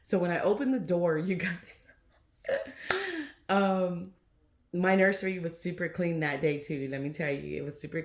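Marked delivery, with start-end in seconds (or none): 2.5-3.4 high laugh. Real, not acted. Laughing at memory.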